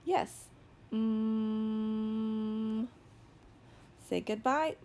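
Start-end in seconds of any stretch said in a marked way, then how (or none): 0.9-2.9 steady, monotone "mmmm" held long; sustained "mm" hum. Shown as a demonstration. Teacher models the sounds on the page, sustained "mm". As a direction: hum the M on one even note. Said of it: "tell me the sounds again".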